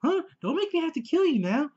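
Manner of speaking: high, hammed-up psycho [name] voice; [name] impression. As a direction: play the stalker with a manic grin. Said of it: "That's my [name] impression".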